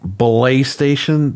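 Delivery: PlayStation said slowly as "Belay Station"; mocking "Belay Station", with buh-LAY drawn out; mimicked mispronunciation. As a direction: stretch an extra syllable into "Belay Station". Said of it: "That's how Dreamcast guy says PlayStation".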